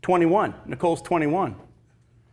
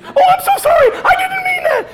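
tearful apology; 7 dB louder than usual. Retold or imitated. And she burst into tears.

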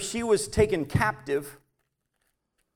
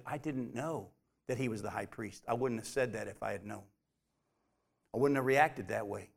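very soft, low, backing down; submissive; 9 semitones lower, 9 dB quieter than usual. Acted. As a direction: retreat into a hushed, humble apology. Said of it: "quickly submitted himself and said".

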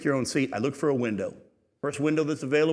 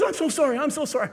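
much higher, fluttery apologetic woman's voice; flustered woman apologizing; 11 semitones higher, faster than usual. Retold or imitated. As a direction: play the mortified petite lady, pitched up high.

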